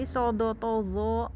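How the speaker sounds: "sodo, tozo" with lips not pushed forward; "sodo, tozo" in round, unpouted Arabic letter sounds. Shown as a demonstration. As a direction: say "sodo, tozo" rounded but without a pout. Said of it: "itu nggak monyong".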